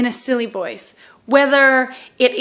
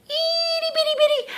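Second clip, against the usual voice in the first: squeaky tiny voice, much higher and softer; 6 dB quieter than usual. Shown as a demonstration. Therapist acting out the tiny font, tiny squeaky voice. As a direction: make it sound teeny-weeny. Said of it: "showing tiny font".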